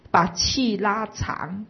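slow the breath down and let every syllable linger; drawn-out slowly. Shown as a demonstration. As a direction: stretch each word out, very slowly. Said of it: "故意拉长". (示范把每个字刻意拉长慢慢讲)

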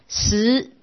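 ordinary talk

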